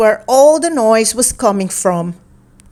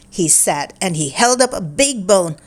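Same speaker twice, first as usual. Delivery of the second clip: plain reading voice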